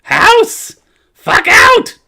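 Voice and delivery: shrieking tiny creature voice; high, loud squeaky yelling; pixie. Acted. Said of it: "It goes, What?".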